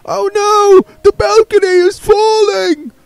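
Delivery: distant actor crying that the balcony falls; panicked actor's cry. Acted. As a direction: shriek the warning from far off. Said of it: "Alexander heard".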